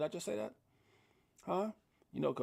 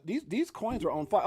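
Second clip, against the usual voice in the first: usual speaking voice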